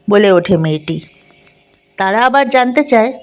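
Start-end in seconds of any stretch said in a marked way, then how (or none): none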